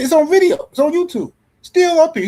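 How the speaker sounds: high, loud insistence; heated retort. Retold or imitated. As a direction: argue it high and loud, quoting someone. Heated quote of argument.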